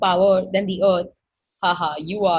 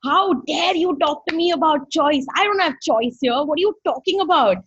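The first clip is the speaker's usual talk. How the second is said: retold outburst, higher pitched; mock-outraged raised voice; indignant yelling; 6 semitones higher, 3 dB louder than usual. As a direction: protest indignantly, re-enacting a yell. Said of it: "I yelled at her".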